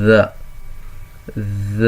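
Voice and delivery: ذ said as dha, tongue between the teeth; teacher models ذ with zabar, as dha; letter sound demo. Shown as a demonstration. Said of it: "اب اس کی sound ہوگی".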